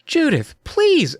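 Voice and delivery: high, drawn-out plea; high-pitched exclamation; crying out. A narrator's reading. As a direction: plead high and stretch the words. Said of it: "I cried".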